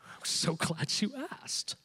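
hushed, smug delight; eager self-righteous reply. Retold or imitated. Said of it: "What would you like me to do?".

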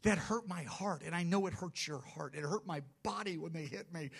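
Jesus tenderly consoling the persecuted, soft and high; tender empathy. Acted. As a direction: speak as one who shares their hurt. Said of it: "He'll be going".

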